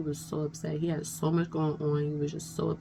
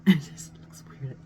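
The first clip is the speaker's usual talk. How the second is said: a quick whisper to herself about the shot; muttered whisper behind a laugh; whispered aside; 3 semitones lower than usual. Real, not acted. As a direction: drop to a whisper, half laughing.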